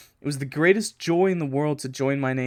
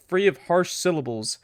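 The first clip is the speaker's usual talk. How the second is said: usual speaking voice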